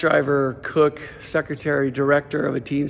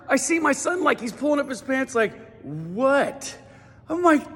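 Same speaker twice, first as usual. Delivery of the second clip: high, pumped-up stand-up delivery; energetic comic storytelling, pitched up; stand-up routine; 9 semitones higher than usual. Acted. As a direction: sell every bit to the crowd, high and hyped. Performs his stand-up routine.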